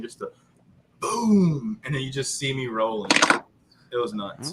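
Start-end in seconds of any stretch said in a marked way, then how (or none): 1.0-1.8 drawn-out, higher-pitched "boom"; imitated explosion boom. Telling friends how the tank blew, imitated boom. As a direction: mimic the percussion of a blast. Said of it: "you can hear the percussion".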